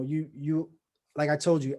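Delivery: usual speaking voice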